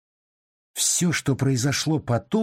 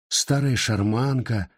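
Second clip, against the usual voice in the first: ordinary delivery, nothing marked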